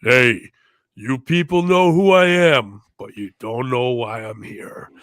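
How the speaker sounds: put-on Cuban-accented wrestler swagger, opening loud then settling; Razor Ramon impression. Fan imitating Razor Ramon's entrance promo in his accent. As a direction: snarl it like Razor Ramon grabbing the mic. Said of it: "infamous moment of Scott Hall getting in that ring".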